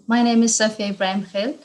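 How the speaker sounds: normal talking voice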